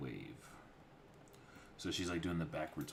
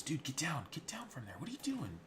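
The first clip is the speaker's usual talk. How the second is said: high alarmed voice; 10 semitones higher than usual. Retold or imitated. Character yelling at someone.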